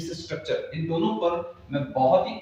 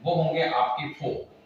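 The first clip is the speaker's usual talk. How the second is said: usual speaking voice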